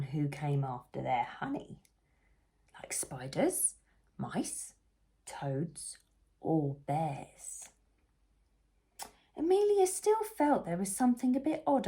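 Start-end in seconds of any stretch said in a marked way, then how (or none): none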